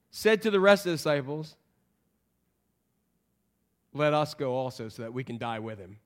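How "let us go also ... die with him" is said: low, soft, deadpan resignation; sarcastic. Acted. Thomas to the other disciples, deadpan sarcastic. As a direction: drop it low and quiet, dryly sarcastic. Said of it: "You hear the sarcasm in there?".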